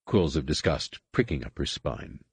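plain reading voice

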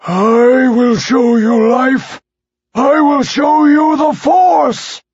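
loud slow menacing shout; booming shout. Acted. Attack begins.

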